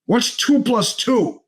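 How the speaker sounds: slow, rising mock menace; last warning. Teacher [name]'s mock menace as he asks one last time. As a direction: spell out a final warning, drawn out. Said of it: "And he goes".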